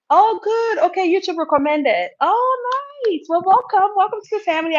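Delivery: high, loud burst of delight; delighted welcome. Real, not acted. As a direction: greet a newcomer excitedly, stretching the "oh". Delighted welcome to a new viewer.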